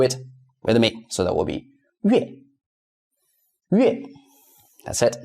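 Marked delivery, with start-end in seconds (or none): 3.7-4.1 "Yue" falling sharply from high; short, high-starting falling "Yue"; fourth tone. Shown as a demonstration. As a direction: drop "Yue" like an emphatic "hey". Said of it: "So fourth tone is hey".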